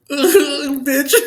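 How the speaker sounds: high, loud laughing cry; laughing through tears. Real, not acted. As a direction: laugh while choking up, real. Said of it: "I'm just crying".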